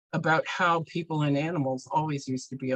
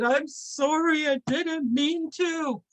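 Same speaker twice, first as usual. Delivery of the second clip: high, drawn-out mock crying; tearful apology; 11 semitones higher, slower than usual. Helper hit with pine needles apologizes, tearful. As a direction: wail it slowly like a crying little one. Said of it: "he started to cry".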